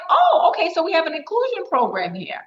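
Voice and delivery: retelling her own pleasant surprise, pitch jumped up; surprised realization. Retold or imitated. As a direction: act out the moment it dawned on her. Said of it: "And I'm like".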